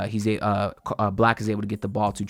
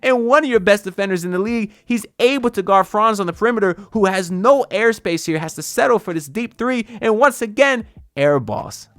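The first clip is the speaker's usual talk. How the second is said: real excitement, much higher voice; carried away by the play, pitched way up; too excited; 9 semitones higher, 3 dB louder than usual. Real, not acted. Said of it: "I'm getting too excited".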